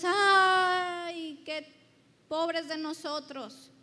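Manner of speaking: a loud, hammed-up lament; mock wailing. Preacher mimicking people lamenting aloud, mock wail. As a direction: wail it like a crowd crying to the winds. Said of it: "No se lamentó a los cuatro vientos".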